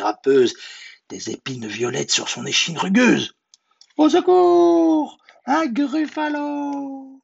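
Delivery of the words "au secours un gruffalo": very high, drawn-out squeal; panicked cry for help. Acted. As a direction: squeal for help in storybook terror. Mouse cries for help.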